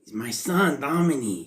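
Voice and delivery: a proud father in a hammed-up Mexican accent; Rey Mysterio impression. Host mimics a wrestler's mock Mexican accent about his son. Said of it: "his Rey Mysterio impression".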